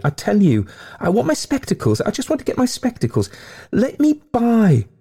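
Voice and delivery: very high, frantic insistence; wild. A narrator's reading. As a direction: insist wildly, desperate to get by. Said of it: "he said wildly".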